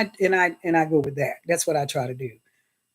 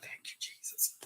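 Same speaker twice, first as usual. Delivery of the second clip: whisper; 21 dB quieter than usual. Real, not acted. Relieved whispered prayer.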